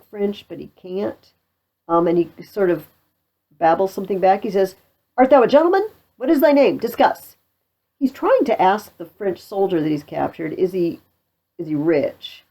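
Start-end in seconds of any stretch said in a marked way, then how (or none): 5.2-7.3 loud, high, demanding questions; blustering interrogation. Acted. Pistol questions his French prisoner, loud and blustering.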